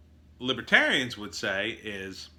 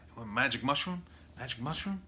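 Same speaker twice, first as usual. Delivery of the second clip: soft, hushed sales pitch; furtive dealer; 7 dB quieter, faster than usual. Retold or imitated. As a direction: sidle up and offer quietly. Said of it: "They're not even gonna have to say it like that".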